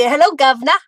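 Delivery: hammed-up movie British accent; mock UK accent. Crafter showing viewers the UK greeting, playful mock British accent. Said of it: "the U K would be".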